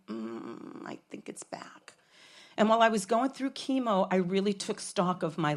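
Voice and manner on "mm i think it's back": faint, breathless inner-voice whisper; an inner voice warns her the cancer is back, whispered. Retold or imitated. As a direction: let it barely escape, like an ominous premonition. Inner voice senses cancer returned.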